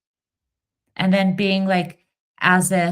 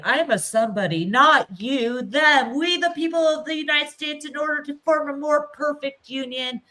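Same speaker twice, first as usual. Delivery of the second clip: mocking whiny voice; 7 semitones higher, 5 dB louder than usual. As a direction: parody an indignant know-it-all. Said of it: "they're gonna be like".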